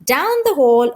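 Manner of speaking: ordinary delivery, nothing marked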